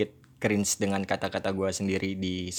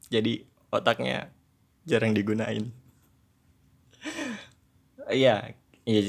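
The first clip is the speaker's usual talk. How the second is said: normal talking voice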